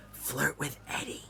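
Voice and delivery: a soft, hushed aside to the actress. Retold or imitated. Director leaning over to coach an actress, a hushed aside.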